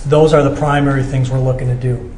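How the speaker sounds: usual speaking voice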